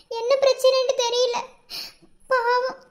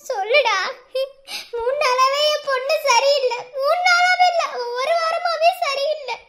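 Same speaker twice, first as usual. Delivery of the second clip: distraught mother in tears; crying, worried complaint; tearful pleading. Acted. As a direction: plead for answers through tears. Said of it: "இப்ப எதுக்கு அழுதுகிட்டு இருக்கீங்க".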